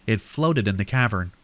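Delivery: plain reading voice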